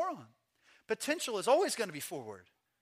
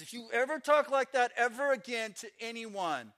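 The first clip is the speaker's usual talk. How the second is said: higher-pitched scolding threat; hammed-up stern warning; threatening manager; 4 semitones higher than usual. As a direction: lay down the law to a misbehaving pet.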